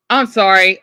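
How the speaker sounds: usual speaking voice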